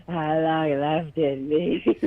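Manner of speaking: slow, strained nerd voice; stupid Jake voice. Retold or imitated. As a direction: drag it out like a wheezing nerd. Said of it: "I do a stupid Jake voice".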